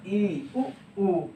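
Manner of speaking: drawn-out close vowels ई and उ; vowel demo. Shown as a demonstration. Teacher sounding out ई and उ for the class. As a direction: hold ई then उ, lips nearly shut. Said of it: "जैसे example क्या है कि".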